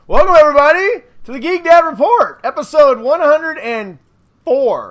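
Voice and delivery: show host intro. Acted. Opening the show.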